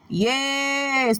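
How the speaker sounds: normal talking voice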